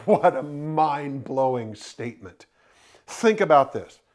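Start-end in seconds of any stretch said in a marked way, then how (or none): none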